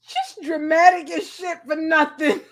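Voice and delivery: high voice breaking with laughter; laughing to tears. Real, not acted. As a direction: get it out while crying laughing. Crying with laughter.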